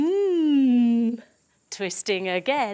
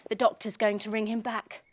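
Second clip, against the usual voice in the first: ordinary delivery, nothing marked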